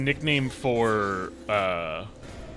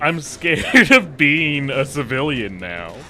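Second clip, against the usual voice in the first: slow, louder, laughing through words; 3 semitones higher, 7 dB louder than usual. Real, not acted. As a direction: laugh while making the excuse.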